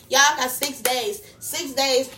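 punchy, emphatic countdown holler; hyped countdown. Real, not acted. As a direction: hype the crowd up for the big day.

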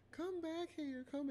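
high calling woman's voice. Retold or imitated. Imitating the woman calling her boyfriend back. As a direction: call after him, high. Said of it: "and I hear, like".

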